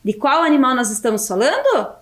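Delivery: ordinary talk, nothing marked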